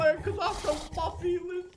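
very high squeaky demon-aunt voice; possessed aunt. Pretend. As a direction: squeal it like a silly possessed creature.

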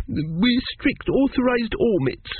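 put-on high, slow little-boy voice; sniffly small boy. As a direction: answer like a child just done crying. Tearful Arthur answers.

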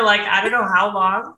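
usual speaking voice

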